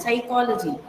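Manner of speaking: psychology starting straight on the S; silent P. Shown as a demonstration. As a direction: leave the P out of psychology. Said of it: "the P will become silent".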